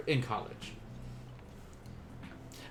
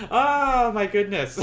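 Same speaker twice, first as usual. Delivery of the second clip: louder, higher and slower, laughing it out; emotional laugh; 5 semitones higher, 6 dB louder, slower than usual. Real, not acted. Teacher finishing the last lesson, laughing with emotion. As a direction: let a laugh break through the words. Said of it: "kinda sad".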